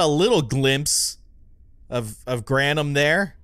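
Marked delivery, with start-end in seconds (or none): none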